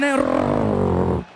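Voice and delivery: deep strangled groan as the car lurches. Retold or imitated. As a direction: choke the words out low. Voice drops into growl.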